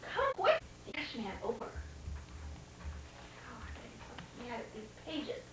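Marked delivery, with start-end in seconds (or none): 0.0-0.6 high friendly call. Acted. Ash Man calling the dog kindly. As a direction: call him over kindly. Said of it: "a friendly voice".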